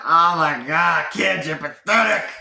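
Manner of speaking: loud, slow, put-on exasperated voice; Grunkle Stan. Voicing Grunkle Stan mocking the kid.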